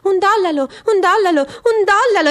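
high, hammed-up street vendor calling out prices; mock vendor cry. The sneering turd mimics a street vendor, high mock cry.

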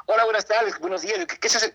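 high-pitched, courteous inquiry; eager polite greeting. Retold or imitated. Young man asking a secretary what they do, high and polite. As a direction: greet brightly up high, feeling your way into a question.

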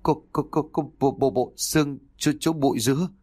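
high, terrified stuttering; panicked stammer. A narrator's reading. Uncle reports the skeleton in the bushes, panicked stammer. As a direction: stutter each word in shock, pitched high. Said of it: "mà hét to thế".

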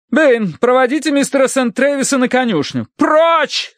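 high-pitched matron giving orders; imperious command. A narrator's reading. Mistress banishing dog and guest to stables, commanding. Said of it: "скомандовала миссис Меринг".

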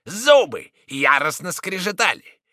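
loud, grave preacher's tale; booming biblical narration. Acted. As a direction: proclaim it like a doom prophet.

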